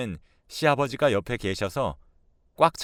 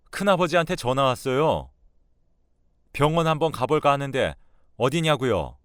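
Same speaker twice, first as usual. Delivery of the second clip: raised, accusing confrontation; exasperated son, higher pitched; angry son; 5 semitones higher, 4 dB louder than usual. Acted. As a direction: lay out the accusation, building to a loud demand.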